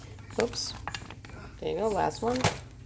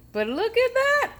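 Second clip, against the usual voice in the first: much higher, louder look at that; proud delight; 4 dB louder than usual. Real, not acted. Vlogger showing off the finished dish, delighted. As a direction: show it off with glee.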